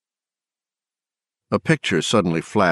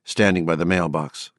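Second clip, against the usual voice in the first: usual speaking voice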